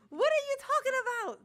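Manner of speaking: high, fast, loud disbelief; what are you even talking about, shrieked; exasperated outburst. Real, not acted. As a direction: fire it back, incredulous and fast. Ranting at Leonard, exasperated.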